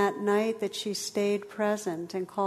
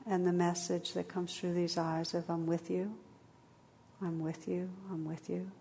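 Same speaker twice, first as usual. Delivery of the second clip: guided meditation; 3 dB quieter than usual. Acted. Leads a meditation.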